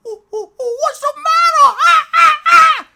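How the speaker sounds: very high, loud monkey shrieks; loud high monkey alarm calls; screaming monkeys. Acted. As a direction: screech like panicked monkeys.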